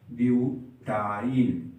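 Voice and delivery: very slow, stretched syllables; drawn-out dictation. Real, not acted. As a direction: spell it out slowly while writing. Saying the name while writing it.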